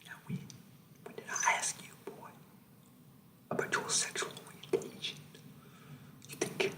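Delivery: menacing drill sergeant in a soft whisper; creepy whispering sergeant. Acted.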